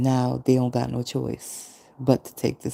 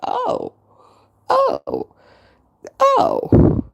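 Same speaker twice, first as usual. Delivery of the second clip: mock cries; 5 dB louder, much slower than usual. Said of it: "I'm like".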